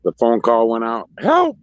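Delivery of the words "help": high falsetto squeal for help; mock-distressed falsetto yelp; mock cry for help. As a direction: put on a squeaky, much higher voice. Mocking those who phone police.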